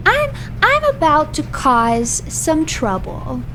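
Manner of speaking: slow, stretched-out mischievous warning; slow teasing declaration; drawn-out announcement. Real, not acted. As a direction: draw out the threat word by word. Repeats the threat, drawn out.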